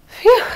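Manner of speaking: high, loud puff of relief; relieved phew. Acted. Mickey relieved the broom is chopped to bits, loud high phew. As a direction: blow it out like a narrow escape.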